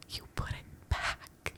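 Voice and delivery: slow, secretive retold whisper; hushed imitation of an accomplice; conspiratorial whisper. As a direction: whisper it slowly, like helping someone cheat. Retelling husband's secret cheating advice.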